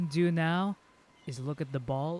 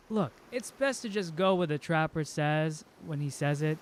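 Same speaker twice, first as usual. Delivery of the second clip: normal talking voice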